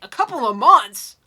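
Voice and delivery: loud, disbelieving repeat; stunned, can't believe it; incredulous. Real, not acted. Shocked repeat of the timing.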